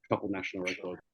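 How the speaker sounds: words dropping out on a bad line; muffled audio. Real, not acted. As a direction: sound cut off and muffled. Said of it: "Do you have your finger over the microphone".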